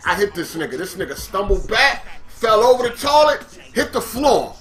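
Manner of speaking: normal talking voice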